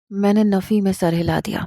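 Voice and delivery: ordinary delivery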